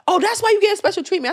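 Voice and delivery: high, fast, sneering mock voice; mocking the rude lady's accusation, high and quick. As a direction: toss it off like a snide stranger. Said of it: "she like".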